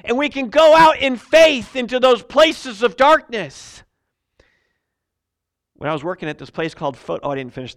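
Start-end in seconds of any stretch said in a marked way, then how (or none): 0.0-3.8 full-force preaching. Acted. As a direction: proclaim victory to the congregation. Preaching to congregation.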